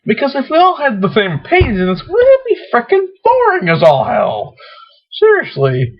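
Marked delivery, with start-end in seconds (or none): none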